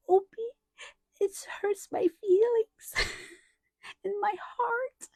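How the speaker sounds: high-pitched, tearful toddler imitation; crying small child. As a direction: wail it like a hurt three-year-old. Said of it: "and he starts crying".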